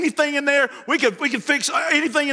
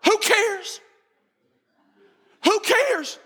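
higher-pitched, emphatic brush-off; dismissive outburst; 8 semitones higher, much slower than usual. Acted. Preacher waving off a question about earth's age, dismissive. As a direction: throw the question right back, pitched up.

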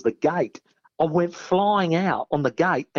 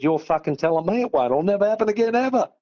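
rising higher, hurried and heated; emphatic retort; 6 semitones higher, faster than usual. Retold or imitated. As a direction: relief turned into an indignant comeback. Retorting to smug guard.

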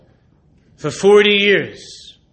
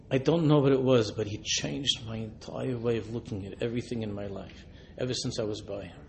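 hushed confession; 7 semitones lower, 12 dB quieter than usual. Retold or imitated. Old man's confession continues.

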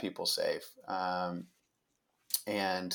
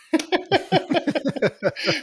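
sudden high laugh bursting out; high burst of laughter; 6 dB louder than usual. Real, not acted. Laughs at forgetting son's birth year.

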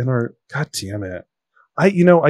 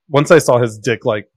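usual speaking voice

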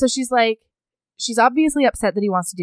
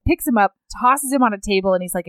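ordinary talk, nothing marked

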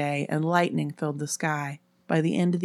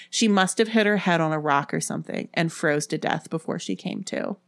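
usual speaking voice

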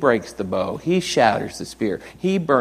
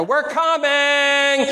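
high, slow drawn-out reply; kids calling back; much slower than usual. Retold or imitated. Kids answering the dinner call, long high holler. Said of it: "and they go".

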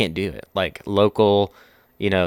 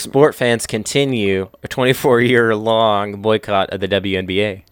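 mock news anchor; 2 semitones higher, 5 dB louder than usual.

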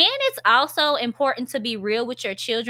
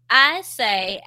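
ordinary talk, nothing marked

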